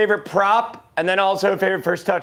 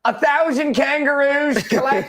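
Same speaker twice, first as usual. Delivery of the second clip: put-on Australian accent, higher-pitched; 6 semitones higher than usual. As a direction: read it out like a drawling Aussie caller. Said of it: "rate the Australian accent".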